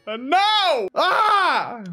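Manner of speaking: high, loud cry of dismay; pained cry. Real, not acted. Streamer crying out as he gets killed. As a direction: yelp as you get taken out. Dying in game.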